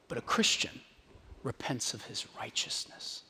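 hushed whisper. Acted. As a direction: drop to a whisper for the punchline. Punchline of quoted sermon.

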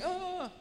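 a high startled cry; high frightened yelp; high cowering yelp. Retold or imitated. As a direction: squeak it out like a scared man. Gang members cowering.

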